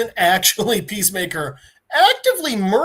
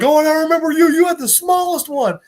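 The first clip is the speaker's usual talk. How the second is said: loud, high mocking glee; gleeful taunt; 9 semitones higher, 5 dB louder than usual. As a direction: rub it in, delighted. Peacemaker gleefully mocking terrorist.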